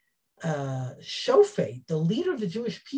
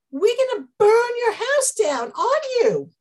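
much higher, louder menacing threat; 12 semitones higher, 7 dB louder than usual. Retold or imitated. Ephraim's men threatening Yiftach, loud and menacing. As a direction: threaten to burn him out. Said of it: "It's a threat".

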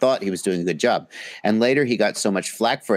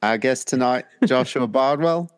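mimicked English accent; 3 semitones higher than usual. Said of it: "I can mimic the accent".

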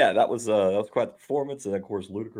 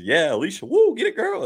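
much higher, loud whooping cheer; hyped-up hype man; 9 semitones higher, 6 dB louder than usual. Retold or imitated. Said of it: "He was like".

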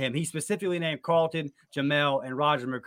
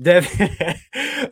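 laughing; 6 semitones higher, 7 dB louder than usual. Real, not acted. Laughing while reading.

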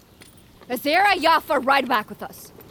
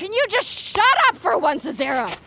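angry outburst; 7 semitones higher than usual. Acted. Sister snaps at sister.

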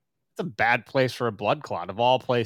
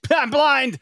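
high, loud mock reveal; surprised reveal; 12 semitones higher, 7 dB louder than usual. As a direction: blurt it out as a shock. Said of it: "he's like".

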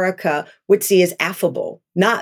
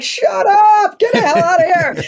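high, loud, thrilled shriek of disbelief; overjoyed surprise, voice jumping up; stunned disbelief; 11 semitones higher, 4 dB louder than usual. Retold or imitated. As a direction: squeal it like you just heard the news in the shower. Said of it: "I'm like".

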